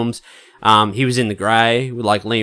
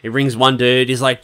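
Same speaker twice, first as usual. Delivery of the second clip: normal talking voice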